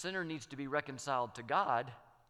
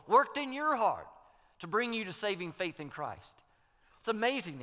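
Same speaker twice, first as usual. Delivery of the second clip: rising preaching; 5 semitones higher, 6 dB louder than usual. Acted.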